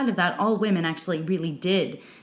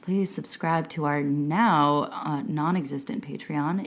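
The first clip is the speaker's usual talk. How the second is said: sultry voice; 2 semitones lower than usual. Pretend. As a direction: purr it like a perfume ad. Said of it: "I really like the sultry voice you just moved into".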